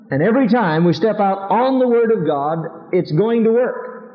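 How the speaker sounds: rising preacher's voice, pressing hard; fervent preaching. Acted. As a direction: preach it with fire. Preaching at full force.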